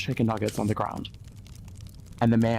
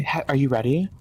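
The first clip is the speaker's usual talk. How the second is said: ordinary talk, nothing marked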